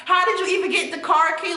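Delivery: imitating her own angry demand; a hint of a heated confrontation; mock angry outburst. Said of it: "I'm like".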